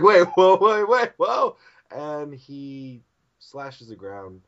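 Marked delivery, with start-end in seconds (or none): 0.0-1.6 high, loud startled yelps; rapid-fire cartoon alarm; alarmed pleading. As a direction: stop the attacker in comic panic. Characters alarmed by the bear.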